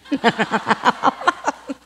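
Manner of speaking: a burst of real laughter, rising; loud laugh. Real, not acted. Preacher laughing at her son's change of heart.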